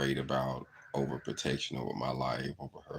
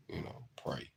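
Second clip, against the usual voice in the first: whisper; 9 dB quieter than usual. Real, not acted. Quiet plea about prayer.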